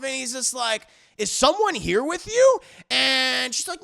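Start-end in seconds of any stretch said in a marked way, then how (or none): 1.2-2.6 loud, high mock accusation; acted suspicion. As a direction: question her as the suspicious husband. Husband suspects his wife.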